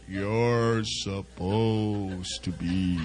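very slow, low and soft, each word stretched; said real slow. Shown as a demonstration. Preacher slowing right down so the congregation gets it, very slow. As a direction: drag out you're supposed to be for the crowd. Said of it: "I'll say it real slow".

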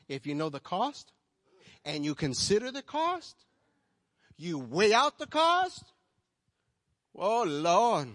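loud, high and slow on the cost; preaching at full force. Real, not acted. Pastor warning the congregation, loud slow preaching. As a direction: press the weight of the cost.

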